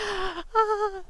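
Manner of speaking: sharp excited gasp, breathy; happy gasp. Real, not acted. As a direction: gasp with delight at the helmet. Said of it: "like, all happy".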